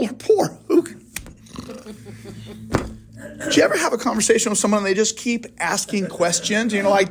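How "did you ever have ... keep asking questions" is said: normal talking voice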